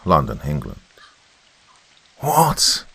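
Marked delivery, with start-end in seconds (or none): 2.2-2.8 high-pitched incredulous shout. A narrator's reading. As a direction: shout it in disbelief, pitch leaping up. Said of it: "shouted Admiral Sir Radabar".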